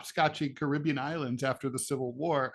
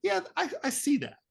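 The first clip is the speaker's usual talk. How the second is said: imagined reaction; 11 semitones higher than usual. Retold or imitated. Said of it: "you're like".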